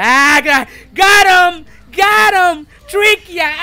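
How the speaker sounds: high, loud gloating yell; excited, high-pitched victory cry; triumphant shout. Real, not acted. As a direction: crow over the catch at full voice. Triumph on catching the opponent.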